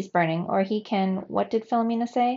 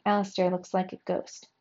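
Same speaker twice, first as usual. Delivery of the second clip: plain reading voice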